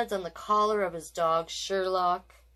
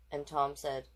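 usual speaking voice

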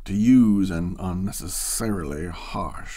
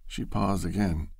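ordinary delivery, nothing marked

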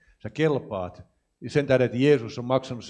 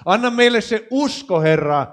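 higher and louder, preaching at full force; full-force healing prayer; 3 semitones higher, 7 dB louder than usual. Acted. Preacher calls on God to heal the congregation, loud fervent preaching. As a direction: cry out for healing over the crowd, pressing each phrase.

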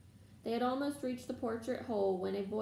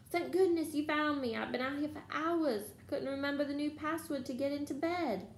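high, plaintive rush of relief; relieved boy; 6 semitones higher than usual. A narrator's reading. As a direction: pour it out in a raised, relieved pitch. Neville, relieved at being found.

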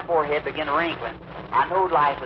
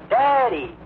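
high, loud wailing call for her daddy; lost blind girl wails high and loud for her daddy; pathetic cry; 9 semitones higher, 4 dB louder, much slower than usual. Retold or imitated. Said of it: "I heard somebody hollering".

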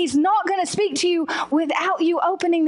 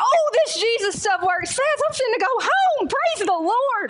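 high-pitched, jubilant outburst; hammed-up joyful exclaiming; elated; 7 semitones higher than usual. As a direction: celebrate like you're going free. Acting out elation at being released.

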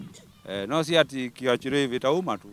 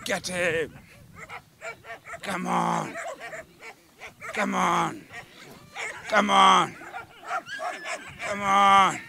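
firm dog commands; 8 semitones higher, much slower than usual. Real, not acted. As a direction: shout the dog on, hard. Urging attack dog to bite.

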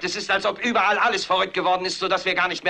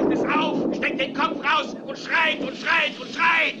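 frenzied command; 9 semitones higher, slower than usual. Acted. Anchor at peak frenzy.

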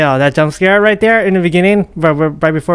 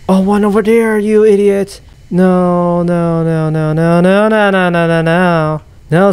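panicked wail; 2 semitones higher, slower than usual. Real, not acted. Gamer spotting one over there, panicked wail. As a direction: keep the no's running together in one wavering wail.

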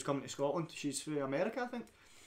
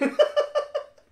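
loud high laugh; 10 dB louder than usual. Real, not acted. Laughs at Florida abuse.

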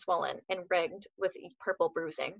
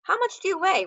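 high-pitched question; 11 semitones higher, 3 dB louder than usual. Acted. Roy's blunt question, high pitch.

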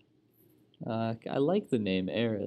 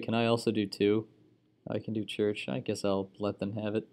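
ordinary talk

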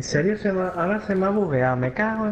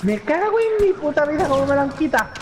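frustrated outburst; 11 semitones higher than usual. Real, not acted. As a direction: vent your rage at the game. Loses kill, frustrated outburst.

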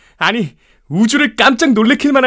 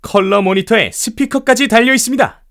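grand product unveiling; 3 semitones higher than usual. Acted. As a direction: sell it to the whole hall like a showman. Jobs unveils Apple II at expo.

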